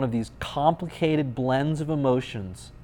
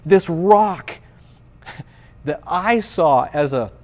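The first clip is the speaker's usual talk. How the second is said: loud, raised-pitch sermon climax; impassioned preaching; 4 semitones higher, 6 dB louder than usual. Real, not acted. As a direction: build with force, stretching key words.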